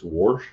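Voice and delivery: wash said as "warsh", with an added R; R put in wash. Retold or imitated. Imitating Lippy's speech to the chat, wash as "warsh". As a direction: slip an R into wash before the "sh". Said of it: "she puts an R in wash".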